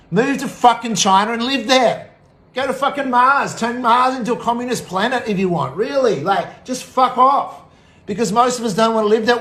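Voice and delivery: angry rant. Real, not acted. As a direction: angrily tell them to clear out. Angry rant telling opponents to leave.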